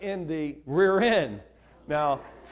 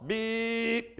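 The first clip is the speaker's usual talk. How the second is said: one held, steady beep like a car horn; imitated long horn beep; 3 semitones higher, 4 dB quieter than usual. Shown as a demonstration. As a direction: stretch the beep out as the long signal. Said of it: "You beep one long".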